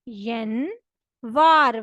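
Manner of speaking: यनवार said slowly, stretched; Russian yanvar. Shown as a demonstration. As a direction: spell out यनवार syllable by syllable. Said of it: "Russian language में कहते हैं यनवार". (यनवार को धीरे, खींचकर बोलें)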